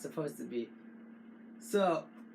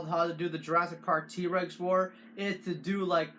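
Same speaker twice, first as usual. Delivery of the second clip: ordinary delivery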